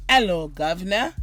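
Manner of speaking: lightly put-on Cockney "Hello, governor"; a jokey hint of a London accent; low, clipped-free playful British greeting; mock British accent. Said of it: "that's what you guys said".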